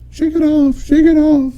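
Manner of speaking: lip-sync it half-heartedly like The Rock; mock lip-sync. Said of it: "was like".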